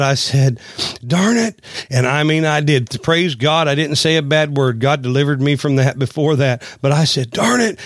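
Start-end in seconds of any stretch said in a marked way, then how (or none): 1.1-1.6 a sudden, higher "Darn it" in retold frustration; mock angry outburst. Preacher retelling his anger in the field, half-hearted mock outburst. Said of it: "But I got so mad". 7.3-7.8 a sudden, higher "Darn it" in retold frustration; mock angry outburst. Preacher retelling his anger in the field, half-hearted mock outburst. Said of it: "And I got so mad".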